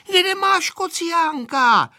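high, drawn-out demanding shout; shouting girl. A narrator's reading. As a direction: yell the question in a high little-girl voice. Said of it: "křičela Aneška na Vojtu". (křik malé holky)

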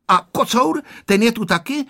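high, eager questioning; pry in a high child's voice; inquisitive little boy. A narrator's reading. Honzík quizzing his mother about the tomcat, inquisitive. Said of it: "vyzvídal Honzík".

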